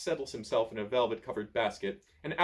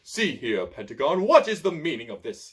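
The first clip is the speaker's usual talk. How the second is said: louder, indignant demand; 3 semitones higher, 6 dB louder than usual. A narrator's reading. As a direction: demand an answer. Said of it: "demands the tall, thin wizard".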